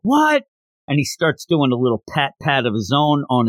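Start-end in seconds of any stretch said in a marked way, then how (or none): 0.0-0.4 high-pitched mock shock; short, high burst of put-on alarm; freaking out. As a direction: imitate a character yelping in disbelief. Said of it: "Goku freaks out".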